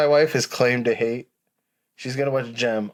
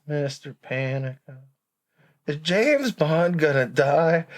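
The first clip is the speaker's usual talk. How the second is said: imitating Travis; 4 semitones higher, slower than usual. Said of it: "And he talked like this".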